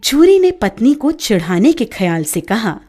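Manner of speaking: ordinary delivery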